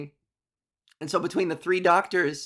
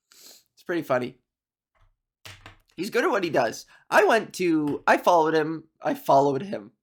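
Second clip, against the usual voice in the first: usual speaking voice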